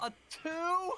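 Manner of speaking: high, loud disbelieving squeal; outraged squeal. Real, not acted. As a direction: shriek out the disbelief. Player outraged at a bad roll.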